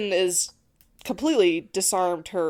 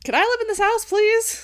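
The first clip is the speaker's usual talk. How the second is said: very high, excited plea; mock-pleading, pitched way up; playful begging; 14 semitones higher, 4 dB louder than usual. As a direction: beg for it in a squeal. Playful begging over cheese wall.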